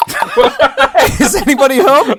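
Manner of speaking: high, laughing knock-knock greeting; chirpy mock caller; silly high voice. Knocking on nads voice.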